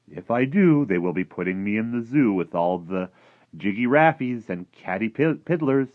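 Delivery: a hint of a fretful giant; lightly voiced friendly giant, worried. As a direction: lightly play the giant protesting.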